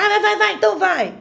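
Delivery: high, exasperated imitation of mum caving; nervous mother giving in. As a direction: act out a worn-down mother waving the child off. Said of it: "Minha mãe tá nervosa".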